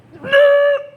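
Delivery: high, loud delighted laugh; burst of laughter. Real, not acted. Reader cracking up at the cards, a burst of laughter. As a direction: laugh out in disbelief. Tarot reader reacts to repeated card.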